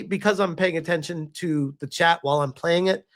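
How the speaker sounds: normal talking voice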